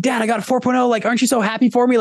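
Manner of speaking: put-on high, eager kid showing off to dad; proud, high-pitched plea for praise; excited kid. Kid excitedly shows dad grades.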